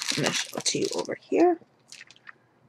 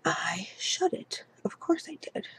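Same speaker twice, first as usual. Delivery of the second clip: whispered aside; 3 semitones higher, 10 dB quieter than usual. Real, not acted. Aside about shutting the calculator.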